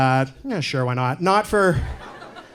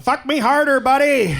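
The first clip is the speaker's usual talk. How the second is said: high, louder hammed-up cry of passion; mock shout; 9 semitones higher, 5 dB louder than usual. Imagined lover shouting in bed, high and loud. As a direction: belt it out like a parody. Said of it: "No girl has ever shouted out".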